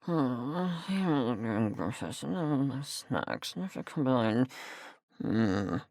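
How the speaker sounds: very low, soft musing; muttering to oneself. Acted. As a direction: murmur absently while thinking. Musing to self.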